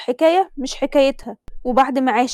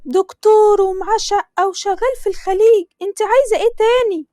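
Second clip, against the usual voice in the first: a mother hurrying her daughter, pitched up; Tasneem's mother; 7 semitones higher than usual. Acted. Mother voiced in story. (صوت أم مصرية عالي ومستعجل)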